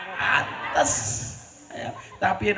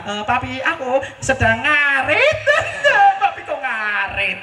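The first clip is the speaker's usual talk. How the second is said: mock reply of a son; 8 semitones higher than usual. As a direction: play a son answering, high and exaggerated. Son's mock reply to 'where's dad'.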